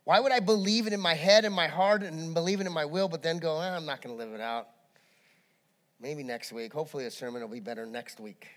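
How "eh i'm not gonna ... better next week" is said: low, soft, dismissive mumble; half-hearted excuse. Acted. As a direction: brush it off quietly, quickly and noncommittally. Acting out a reluctant believer.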